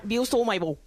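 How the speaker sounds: "Bill stole my ball", the L's vocalized and run together; light Cockney run-through. Shown as a demonstration. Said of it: "sonaría como".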